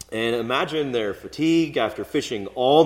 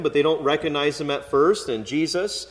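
ordinary delivery

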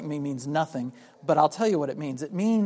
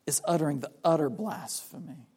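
ordinary delivery

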